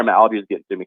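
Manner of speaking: ordinary delivery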